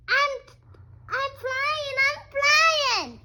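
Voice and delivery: very high, loud squeal of joy; excited shout. Acted. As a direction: shriek it like a duckling taking off. Duckling flying excitedly.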